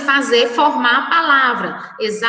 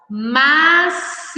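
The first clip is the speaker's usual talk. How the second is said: "macio" stretched, long drawn second syllable; 3 semitones higher than usual. Shown as a demonstration. Teacher giving children the second word, syllable by syllable. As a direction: draw out "macio" in slow syllables.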